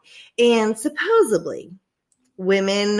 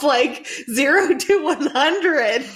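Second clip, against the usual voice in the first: laughing; 5 semitones higher, slower than usual. Real, not acted. Laughing while telling story.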